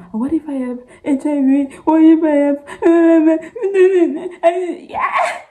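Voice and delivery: high, loud tearful panic with a broken laugh; a scared daughter crying to her dad on the phone; panicked crying. Retold or imitated. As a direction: let the fear break through into sobs. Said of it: "I was like".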